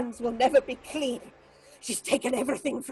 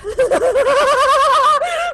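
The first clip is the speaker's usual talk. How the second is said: high, loud, unhinged cackle; mad cackle; 13 semitones higher, 11 dB louder than usual. Acted. Marlena breaking into madness, a loud high cackle. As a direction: burst into a crazed high laugh. Madwoman's laughing outburst.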